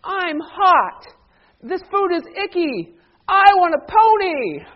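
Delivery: high, loud, bratty whine; put-on tantrum voice, building to a loud finish; spoiled whining milk carton. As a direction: wail it like a pampered child, stretching the final demand. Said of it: "the carton says to me".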